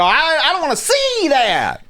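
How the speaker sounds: high-pitched mock-horrified outburst; horrified cry. Babysitter slamming the bathroom door, high horrified cry.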